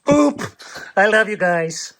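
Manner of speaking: high, playful little boop. Real, not acted. Man booping his dogs at bedtime, playful high boop.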